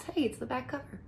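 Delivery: ordinary delivery, nothing marked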